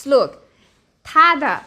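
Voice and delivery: normal talking voice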